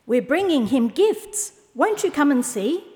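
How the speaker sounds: high-pitched, lively invitation; eager camel. A narrator's reading. Camel inviting owl along, high and eager. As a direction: coax the listener along eagerly.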